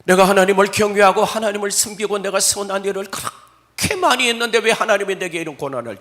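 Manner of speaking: loud, pleading protest; Job's loud complaint. Acted. Preacher voicing Job's protest to God, loud and pleading. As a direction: cry out the unfairness, loud.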